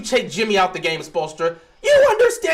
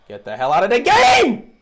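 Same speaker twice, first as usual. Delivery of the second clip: louder burst, shouting at the screen; frustrated yell; 6 dB louder than usual. Real, not acted. Fan shouting at the TV to bench Zeller, angry yell. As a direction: yell at the player to get off the court.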